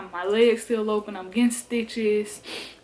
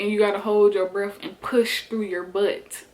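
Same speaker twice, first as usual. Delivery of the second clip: ordinary talk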